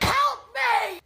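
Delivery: high, loud yelp for rescue; mock cry for help. Trapped driver begging for rescue, mock cry for help.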